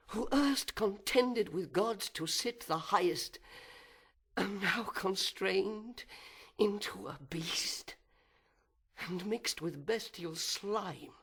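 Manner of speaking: a proud fallen angel brooding aloud, swinging from whispers to outbursts; fallen angel's soliloquy. Acted. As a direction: seethe with envy and wounded pride, then spit out the vengeance.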